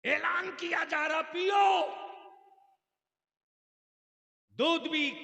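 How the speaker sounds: a very high, very loud crier, easing down bit by bit; mock announcement. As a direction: call it out like a street crier. (मज़ाकिया ऐलान, ऊँची से धीरे-धीरे उतरती पुकार)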